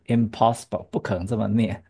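ordinary delivery

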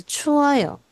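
“추워요”连起来一口气快速念出; 把“추워요”连读，说得又快又顺; 念快一点. Shown as a demonstration. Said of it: "要念快一点的时候就是추워요". (老师示范正常语速连读“추워요”)

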